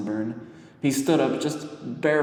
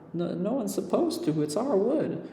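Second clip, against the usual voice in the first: put-on higher, quicker young girl; lightly voiced flustered girl; girl character voice; 10 semitones higher than usual. A narrator's reading. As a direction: rush the words like a shy child.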